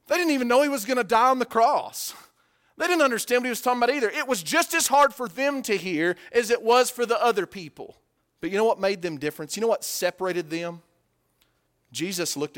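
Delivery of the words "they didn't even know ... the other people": loud, high, emphatic preaching; full-force preaching. Real, not acted. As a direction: drive it home from the pulpit.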